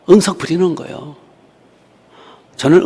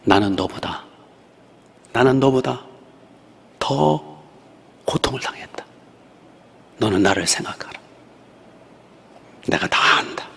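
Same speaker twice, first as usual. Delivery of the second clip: hushed voice of the Lord. Retold or imitated. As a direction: soothe her as a gentle father. Voicing the Lord's answer.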